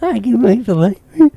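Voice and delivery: high-pitched nerdy clerk impression; hammed-up smitten nerd; imitated dork. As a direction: echo the eager dork in a put-on voice. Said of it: "that dork's like".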